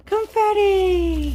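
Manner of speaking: high, loud, slow cheer; excited cheer. Real, not acted. Throwing confetti for viewers, a high drawn-out loud cheer. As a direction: stretch it out like a party shout.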